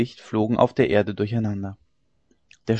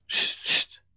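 soft shushing hiss; hushing; 4 dB quieter than usual. A narrator's reading. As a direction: shush them quietly. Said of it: "machten die andern Dirnen".

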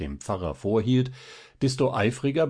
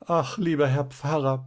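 higher, tearful narrated plea; weinerliche Stimme; 6 semitones higher than usual. A narrator's reading. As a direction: lament it like a woman close to tears. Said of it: "begann sie endlich mit weinerlicher Stimme". (weinerlich klagende, höhere Stimme)

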